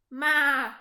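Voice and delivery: loud bleating maa; goat bleat. A narrator's reading. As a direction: yell like a goat. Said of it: "yelled Goat".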